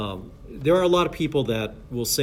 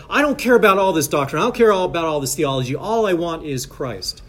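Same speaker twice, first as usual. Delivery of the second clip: higher, louder opening, slowing to the end; imitated earnest objector, raised voice; mock noble declaration; 4 semitones higher, 5 dB louder than usual. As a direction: proclaim it like a noble statement. Acts out the noble-sounding statement.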